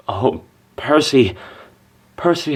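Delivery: normal talking voice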